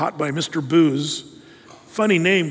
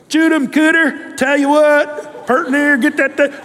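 put-on Deep South accent; 7 semitones higher, 4 dB louder than usual. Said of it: "people down in the Deep South".

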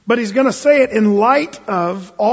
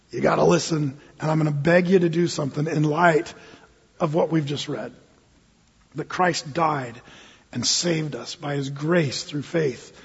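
tender plea; 5 semitones lower, 6 dB quieter than usual. Acted. Paul's plea softened, slowed.

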